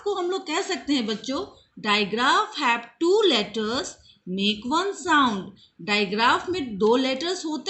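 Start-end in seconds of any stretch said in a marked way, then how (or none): 1.9-5.4 slow, word-by-word English rule; slow dictation. Shown as a demonstration. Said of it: "teacher dictates digraph rule".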